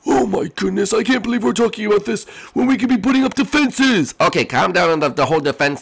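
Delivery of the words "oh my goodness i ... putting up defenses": high, half-sorry little voice; high squeaky character voice. Acted. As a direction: apologise in a squeaky voice.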